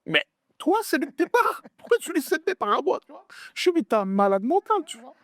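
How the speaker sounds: very high, incredulous outburst; high-pitched indignant protest; outraged retort. Retold or imitated. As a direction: replay telling someone off, voice shooting up. Retold outburst at partner over shares.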